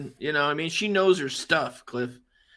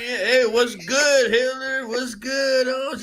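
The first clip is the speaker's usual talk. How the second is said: put-on higher, drawn-out Mexican-American stoner accent; Cheech; 5 semitones higher than usual. Co-host riffs on the failed accent, Mexican-American stoner voice. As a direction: ham up a sing-song Chicano accent. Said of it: "but, like, with Cheech's voice".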